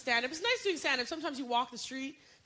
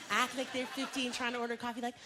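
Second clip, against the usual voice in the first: ordinary talk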